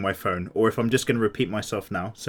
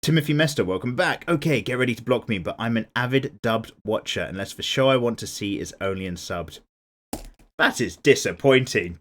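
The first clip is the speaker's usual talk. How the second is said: ordinary talk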